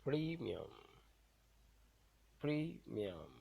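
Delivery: Premium with a loud first syllable; teacher's demo of PRE-mium; stressed syllable. Shown as a demonstration. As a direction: punch PRE in Premium, then let it fall. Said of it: "the one that will be pronounced louder".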